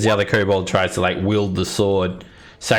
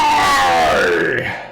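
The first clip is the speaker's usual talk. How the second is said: very high, loud drawn-out shout; wild attacking creature's shriek; murderous battle cry; 6 dB louder than usual. Acted. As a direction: scream it as a living sword lunging to kill. Sword Sagaroth lunges to attack.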